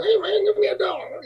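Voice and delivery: very high imitation of little guy; high put-on voice pestering someone for cash; tiny man's voice. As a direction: squeal it like a tiny distant beggar. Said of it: "I hear him going".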